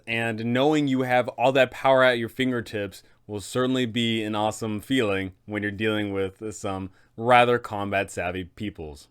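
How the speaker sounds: usual speaking voice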